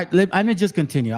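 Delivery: ordinary talk, nothing marked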